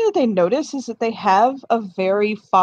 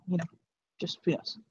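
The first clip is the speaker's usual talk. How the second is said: much softer, confiding; hushed; 13 dB quieter, faster than usual. Acted. Jack hushes to Darcy, keeping it between them.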